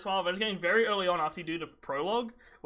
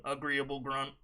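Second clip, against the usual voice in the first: deadpan robotic placeholder line, lower and softer; text-to-speech voice; 4 semitones lower, 5 dB quieter than usual. Retold or imitated. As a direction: imitate a computer reading the subtitle. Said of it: "text-to-speech voice that's a placeholder".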